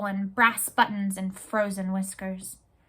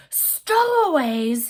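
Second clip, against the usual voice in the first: slow, drawn-out loud roar; captain roaring at news of stowaways; 3 semitones higher, 5 dB louder, much slower than usual. Acted. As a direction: stretch the word in fury. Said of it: "he roared".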